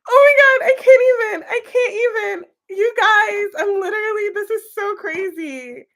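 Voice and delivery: high, loud and breathless exclaiming; can't contain the shock; overwhelmed. Real, not acted. Reader overwhelmed by her own reading, high and loud.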